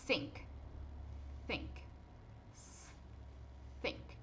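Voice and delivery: "Think" repeated softly, tongue between teeth; unvoiced th. Shown as a demonstration. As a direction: hiss the th of "Think" through the teeth. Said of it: "prender um pouquinho essa língua".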